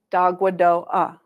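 syllables run together as one word. Shown as a demonstration. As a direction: join "Da, gua, do, ah" up without pauses. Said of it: "say it a little bit quicker".

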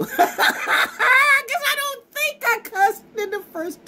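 high, slow, laughing through it. Real, not acted. Laughing to the viewers about not cussing. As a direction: giggle out the words. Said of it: "Mommy says I'm cool".

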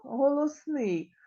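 normal talking voice

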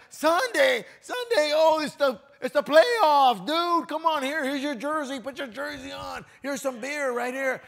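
high, loud and hyped, pushing the playoff game; a pal waving a jersey and beer; mock football buddy. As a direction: tempt him like a sports-mad friend. A buddy luring him to football.

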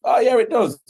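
high, loud self-answer; mock realisation. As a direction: answer your own question triumphantly. Answering own checklist question, mock realisation.

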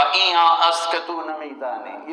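loud, haughty dismissal; arrogant. Retold or imitated. Scholar Maulana answering the villager he thinks ignorant, arrogant. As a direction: put the ignorant visitor down with pride. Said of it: "بڑے تکبر سے کہا".

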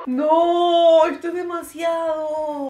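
loud, slow, stretched-out protest; real distressed outcry; overwhelmed shout. Real, not acted. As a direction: shout that it's too much. Overwhelmed by the video.

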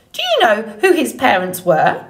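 swooping, squawky bird voice; suspicious old goose. Acted. Goose questioning the robot, suspicious squawk. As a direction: probe like a wary old goose.

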